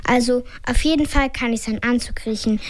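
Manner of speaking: plain reading voice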